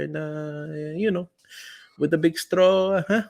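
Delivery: hammed-up Filipino accent, climbing in pitch; mock Filipino-accented flirting, huh on the end; Filipino-accented pickup.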